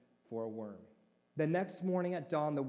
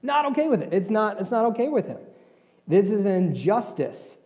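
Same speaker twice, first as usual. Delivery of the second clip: louder, driving sermon delivery; full-force preaching; 12 dB louder than usual. Acted. Pastor preaching loudly about Jonah's anger. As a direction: preach it hard to the congregation.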